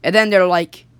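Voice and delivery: ordinary delivery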